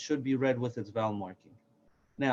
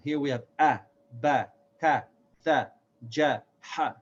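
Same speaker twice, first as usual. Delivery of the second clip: usual speaking voice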